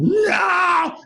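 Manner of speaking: high, loud cry of horror; horrified yell. Retold or imitated. Doll head blinking at him.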